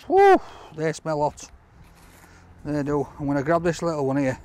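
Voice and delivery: usual speaking voice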